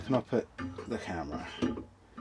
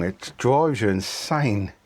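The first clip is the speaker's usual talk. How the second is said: ordinary talk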